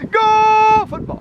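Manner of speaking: shouted high cheer, stretched slow; booming stadium cheer. Acted. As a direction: chant it like a fan in the stands. Cheering chant for football.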